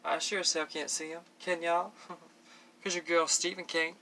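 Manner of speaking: playful put-on country twang; country accent, mock-sassy questions. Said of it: "That was my country accent".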